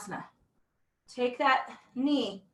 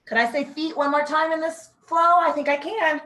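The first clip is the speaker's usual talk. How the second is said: ordinary talk, nothing marked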